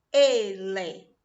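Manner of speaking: "Ele" said loud as e-le; two clean beats in "Ele"; syllables shown. Shown as a demonstration. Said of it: "abriu a boca duas vezes". ("Ele" falado em duas sílabas)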